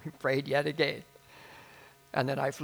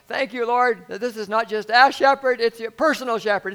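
loud, raised praying voice; fervent prayer; 7 semitones higher, 10 dB louder than usual. Retold or imitated. Pastor praying again with the brothers, fervent prayer. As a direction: pray it out high and strong.

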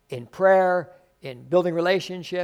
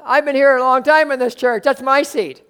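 higher, louder mock-indignant saint; hammed-up possessive churchgoer; entitled church member; 7 semitones higher, 5 dB louder than usual. Longtime member telling a newcomer to move, mock-indignant. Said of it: "when the saints say".

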